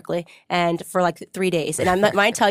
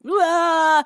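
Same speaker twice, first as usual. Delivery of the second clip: high, sudden imitated wail; quick high imitated cry, lightly played; mock sudden cry; 9 semitones higher, 4 dB louder than usual. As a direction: blurt out a brief mock sob out of nowhere. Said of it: "If you were just crying like that".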